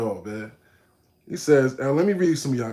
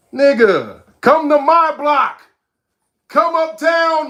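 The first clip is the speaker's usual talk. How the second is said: loud, high, drawn-out call to come uptown; heated shout; 11 semitones higher, 7 dB louder, slower than usual. Real, not acted. Streamer daring a chat critic to come uptown, heated shouting. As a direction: dare them to come to your block, loudly. Challenging critic to visit his block.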